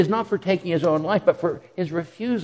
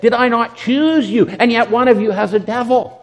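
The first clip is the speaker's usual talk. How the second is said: higher, louder stern challenge to the disciples; Jesus confronting the twelve; 5 semitones higher, 5 dB louder than usual. Retold or imitated. Jesus to the twelve, a raised, stern rebuke. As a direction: ask it as a sharp rebuke. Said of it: "Jesus, looking at the twelve, said".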